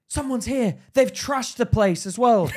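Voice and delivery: high, urgent officer's shout; mock police alarm. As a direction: raise the alarm like police storming in. Armed police storming in, acted out.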